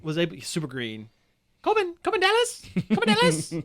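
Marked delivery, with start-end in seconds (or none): 1.6-3.5 high, loud squealing Ruby Rhod voice; Chris Tucker impression. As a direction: shriek the name like Ruby Rhod. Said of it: "terrible Chris Tucker impression".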